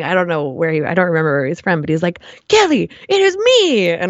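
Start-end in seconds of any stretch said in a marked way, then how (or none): 2.5-3.9 high, slow mock Italian accent. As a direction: ham up an Italian guy's accent, pitched high. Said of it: "like, Italian accent or something".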